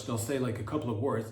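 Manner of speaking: ordinary talk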